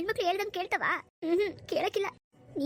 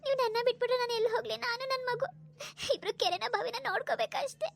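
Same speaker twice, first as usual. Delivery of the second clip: acted crying, words broken by sobs; tearful pleading; 4 semitones higher than usual. Wife begs drunkard husband not to leave her, tearfully. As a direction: plead through tears, pausing for breath. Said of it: "ಅಳ್ಬೇಡ ಸುಮ್ನಿರು".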